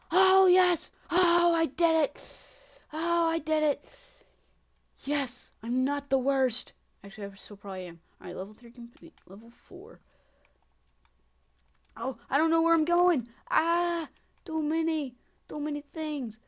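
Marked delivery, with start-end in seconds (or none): 0.1-6.7 loud, high, stretched-out cheer; triumphant shout. Real, not acted. Player just beat level three, triumphant shouting. 12.0-16.4 high, loud overwhelmed wail; panicked cry. Real, not acted. Player lost among too many obstacles, panicked cry.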